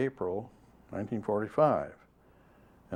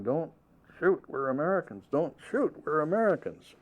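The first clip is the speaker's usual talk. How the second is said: mock shout pitched higher; retold yelling; 7 semitones higher than usual. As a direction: call out the plea in a higher voice, only a mild mock yell. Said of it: "we started yelling".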